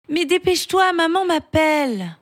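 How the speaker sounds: put-on a kid who wants to go eat; lightly hurried young boy; impatient boy. Julien hurried.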